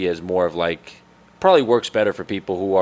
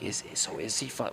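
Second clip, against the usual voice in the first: much softer, imitating a discreet question; hushed aside; 15 dB quieter than usual. Imitating worried guests asking each other, hushed aside.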